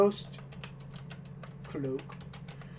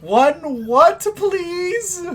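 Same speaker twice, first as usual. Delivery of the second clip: higher, louder baffled question; baffled shopkeeper; 10 semitones higher, 4 dB louder, much slower than usual. Acted. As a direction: ask back in disbelief. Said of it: "With the tongue to s".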